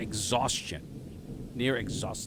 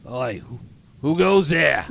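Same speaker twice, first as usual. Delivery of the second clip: loud Cockney gate guard's challenge; rough-and-ready Cockney shout; shitty Cockney accent; 3 semitones higher, 10 dB louder than usual. Acted. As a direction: bellow it like a Cockney sentry. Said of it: "my shitty Cockney accent".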